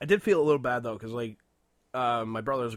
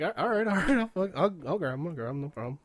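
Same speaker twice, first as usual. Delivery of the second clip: calming her down; 5 semitones higher than usual. Retold or imitated. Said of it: "So I was like".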